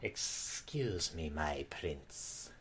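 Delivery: slow low servant voice. Acted. Servant interrupts slowly.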